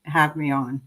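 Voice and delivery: ordinary talk, nothing marked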